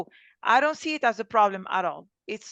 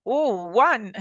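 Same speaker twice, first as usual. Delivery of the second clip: ordinary talk, nothing marked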